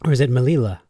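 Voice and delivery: quick, emphatic m'LEE-la; said fast. Shown as a demonstration. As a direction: rattle off "Melila" with syllables squeezed together. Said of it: "Are they saying it that fast?".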